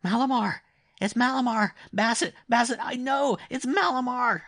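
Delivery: put-on very high, loud delirious boy; high-pitched repeated exclamations of a delirious child; child's feverish cry. A narrator's reading. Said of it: "So the child cried".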